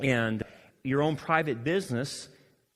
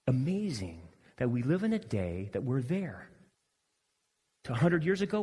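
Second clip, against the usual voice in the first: ordinary talk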